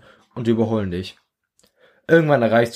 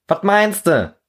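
higher, louder puzzled question; puzzled Arthur; 10 semitones higher, 4 dB louder, slower than usual. Acted. Arthur asking the old soldier, higher and louder. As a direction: ask it like a baffled young soldier. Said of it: "Fragt Arthur".

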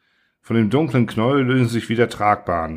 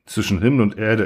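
usual speaking voice

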